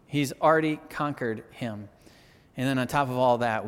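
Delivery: normal talking voice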